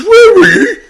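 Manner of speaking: high laughing imitation; laughing mock question. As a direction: laugh through the quote. Retold friend's question, laughing.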